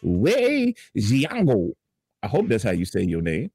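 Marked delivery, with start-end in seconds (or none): 0.0-1.7 higher, slow mock-anchor read; news read. Fill-in anchor reads the airport story, mock news read. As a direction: stretch key words like a grand newsreader.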